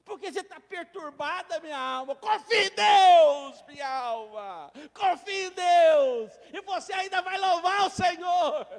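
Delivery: loud, rising rebuke to his own soul; preacher rebuking his soul. Acted. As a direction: shout at your own soul to trust God. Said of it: "e aí ele fala".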